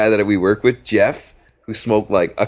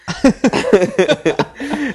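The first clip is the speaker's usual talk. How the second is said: high giggle. Retold or imitated. Manny's laugh imitated.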